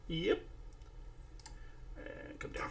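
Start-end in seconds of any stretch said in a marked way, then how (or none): 2.0-2.7 whisper. Real, not acted. Quiet aside while working.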